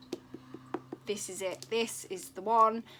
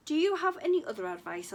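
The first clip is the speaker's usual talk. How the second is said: put-on eager boy; 6 semitones higher, 4 dB louder, much faster than usual. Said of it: "asked the boy".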